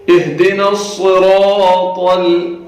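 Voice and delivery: slow صراط with thick sad and ra; heavy sad. Shown as a demonstration. Teacher modelling the heavy sad. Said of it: "صاد بھی موٹا ہوگا".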